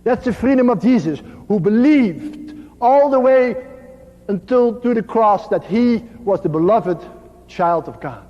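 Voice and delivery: ordinary talk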